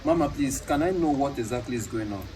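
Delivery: usual speaking voice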